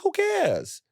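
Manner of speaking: very high, careless shrug; dismissive quoted retort. Retold or imitated. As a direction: fling it away high, then drop. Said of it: "I was like".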